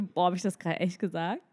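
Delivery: ordinary delivery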